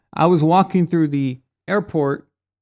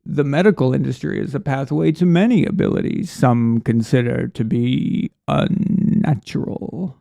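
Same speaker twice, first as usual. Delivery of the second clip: theatrical re-reading. Acted. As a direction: intone it ominously, stretching out the last words. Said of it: "I gotta read this differently".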